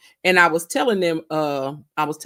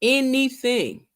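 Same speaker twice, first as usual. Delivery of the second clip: slow, higher, drawn-out anything; emphatic; 6 semitones higher, slower than usual. Real, not acted. Telling the chat about her best friend, emphatic anything. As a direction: lean on the word anything slowly. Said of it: "I could tell her anything".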